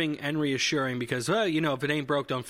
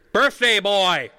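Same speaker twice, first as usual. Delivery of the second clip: much louder, higher angry yell; aggressive shout; 6 semitones higher, 9 dB louder, much slower than usual. Retold or imitated. Patient mimicking the next test word, aggressive shout. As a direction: bellow it like a furious test voice.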